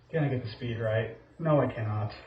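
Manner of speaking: ordinary talk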